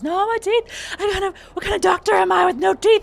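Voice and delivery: high, loud frantic wail about his teeth; panicked wail. Acted. Game master voicing a doctor panicking over his teeth, high frantic wail. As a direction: shriek it in total panic. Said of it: "He says".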